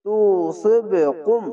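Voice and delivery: slow তুসিবকুম with the ব knocked before কুম; qalqala bounce. Shown as a demonstration. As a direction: bounce the ব, then glide into কুম. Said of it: "কলকলা ধাক্কা মেরে নিচে আসবে". (কলকলা দিয়ে তুসিবকুম)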